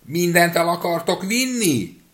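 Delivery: high, loud and slow shout. A narrator's reading. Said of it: "még hangosabban kiáltott".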